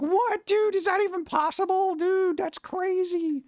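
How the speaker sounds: squeaky astonished fan voice; mock amazed viewer. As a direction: play the wowed viewer, over the top. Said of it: "You're like".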